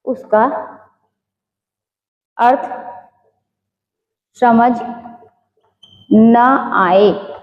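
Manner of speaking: word by word with long pauses; slow dictation, leaving time to write. Real, not acted. Teacher dictating notes to students, dictation.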